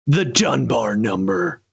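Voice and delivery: sinister. Acted. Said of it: "as sinister as you're making it sound".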